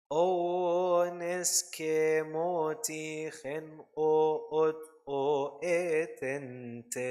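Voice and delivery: slow sung line, each "o" leaned on; emphasis on the o. Shown as a demonstration. Choir teacher repeating the demo for singers, slow and sung. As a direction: keep weighting the "o" sounds as you sing. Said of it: "So put the emphasis on the o".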